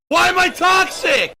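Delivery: high, loud outraged yell; outraged shout. Acted. Streamer unleashing the beast, outraged shout. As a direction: shout it high in full indignation.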